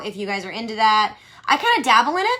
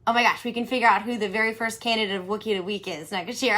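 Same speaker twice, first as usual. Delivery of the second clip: ordinary talk, nothing marked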